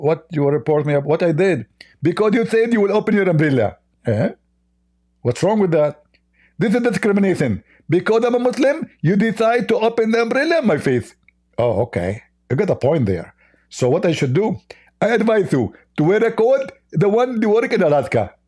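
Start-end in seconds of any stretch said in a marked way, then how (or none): 2.0-3.8 high, lisping complaint; Zakir Naik. Acted. Mock preacher complaining about the umbrella, high and lisping. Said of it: "Hey, Zakir Naik". 6.6-11.1 high, indignant lisping caricature; Zakir Naik. Acted. Mock preacher protesting discrimination to the host, high and lisping. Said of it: "Hey, Zakir Naik". 15.0-18.3 high, lisping mock advice; Zakir Naik. Mock preacher advising the host on a coat, high and lisping. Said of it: "Hey, Zakir Naik".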